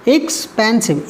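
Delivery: slow expensive in three beats; three syllables. Shown as a demonstration. Teacher counting syllables, slow expensive in three parts. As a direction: break expensive into x, पेन, सिव. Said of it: "तीन syllable का है".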